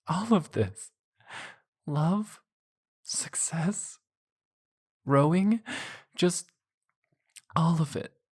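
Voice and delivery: high, emotional female voice; woman's voice. Acted. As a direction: lift into her teary voice.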